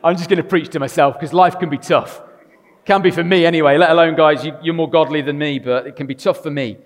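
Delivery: loud, rising preacher's voice; full-voiced sermon delivery; preaching. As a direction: preach it out with force.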